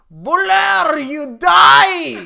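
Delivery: shouted high and loud, laughing through it; battle cry. Acted. Reading Patchy's spell in the fanfic as a loud, laughing battle cry. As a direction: yell the attack like a villain. Acting Patchy's attack line.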